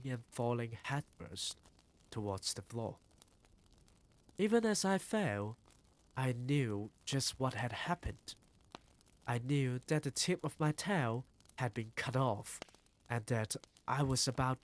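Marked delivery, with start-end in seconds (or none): none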